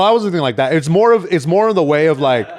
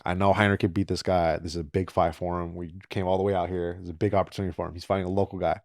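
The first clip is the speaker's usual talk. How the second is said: inner thought; 8 semitones lower, 11 dB quieter, faster than usual. Retold or imitated. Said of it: "and I'm like".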